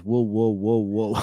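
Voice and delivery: stuck on whoa, repeating it in a mock stutter; stuttering imitation. Shown as a demonstration. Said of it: "it was just like".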